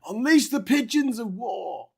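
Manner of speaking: high, loud hammy war cry; mock battle cry. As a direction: shout like a general rallying troops. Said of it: "funny for someone to shout".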